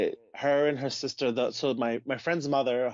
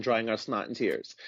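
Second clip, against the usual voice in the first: ordinary delivery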